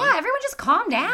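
high, loud mock plea to calm down; exasperated plea. Podcast host telling angry public off, exasperated plea. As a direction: throw up your hands at everyone.

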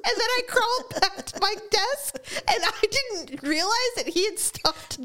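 high, squeaky laughing speech; talking through laughter. Real, not acted. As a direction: struggle to get the story out while cracking up. Telling own story while cracking up.

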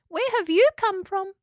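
very high, curious squeak; little echidna. A narrator's reading. Spike asks where the house came from, little echidna voice. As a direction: ask in a tiny creature's voice.

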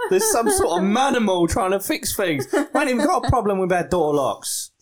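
hammed-up household grumble; indignant householder. Householder reports strange manimal at door, mock indignant. Householder complains about the caller.

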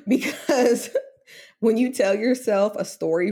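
laughing through tears, voice up high; a nervous, teary laugh breaking in; emotional laugh. Real, not acted. Guest telling the host how saying it felt, teary laugh. Guest tearful about wanting baby.

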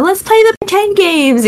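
put-on high, playful kid voice; childlike voice. Retelling childhood games with cousins, high playful kid voice. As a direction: mimic an eager child asking to play. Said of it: "we were always like".

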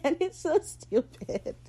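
ordinary delivery

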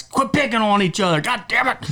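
high-pitched mock holler; imitating yelling dads. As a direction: mimic a fed-up dad scolding squabbling kids. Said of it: "Monk and Dave yelling at us".

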